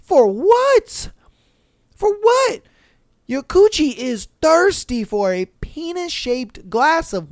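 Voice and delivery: high, slow, disbelieving scold; high-pitched, slow mock outrage at her staying; incredulous scolding. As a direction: scold a friend for putting up with it. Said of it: "And I'm like".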